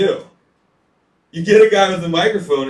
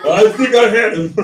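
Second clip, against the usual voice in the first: proud loser; 6 semitones higher, 7 dB louder than usual. Retold or imitated. Concussed guy bragging at bar.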